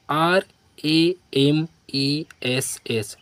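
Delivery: letter by letter, spaced out; dictating letters to a class; slow spelling. Shown as a demonstration. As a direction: spell each letter slowly. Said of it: "spelling the letters out".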